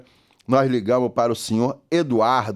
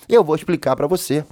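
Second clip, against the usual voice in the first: ordinary delivery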